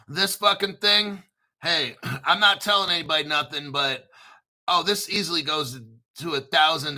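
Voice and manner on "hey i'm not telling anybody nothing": ordinary talk, nothing marked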